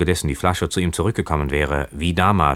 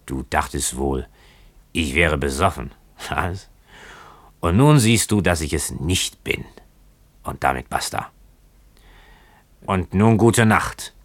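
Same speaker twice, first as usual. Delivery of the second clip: put-on halting drunken speech in short bursts; drunken boatswain. A narrator's reading. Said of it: "wie die eines gänzlich Betrunkenen klang".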